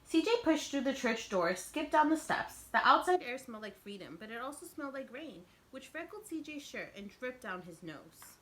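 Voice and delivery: lively storybook narration; teacher reading a picture book aloud; reading to children. A narrator's reading. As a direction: read it out to the kids with expression. Reads picture book to class.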